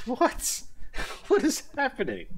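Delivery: high incredulous squeak; mock disbelief. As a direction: react stunned. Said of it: "And I'm like".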